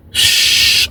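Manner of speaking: long voiceless "shh"; hushing hiss. Retold or imitated. Prisoner lying on his bunk thinking, long hushing hiss. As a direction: let out a drawn-out shush.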